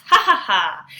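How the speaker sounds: loud spelled-out jeering laugh; mocking laugh. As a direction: laugh at him like a mean classmate. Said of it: "and laughs".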